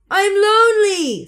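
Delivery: high, slow, sad echoing call; lonely echo. A narrator's reading. The echo repeating the lonely words, high slow call. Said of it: "said the voice from across the pond".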